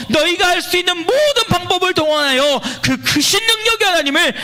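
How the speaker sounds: rousing exhortation to praise; impassioned preaching. Real, not acted. Preaches the psalm at full force.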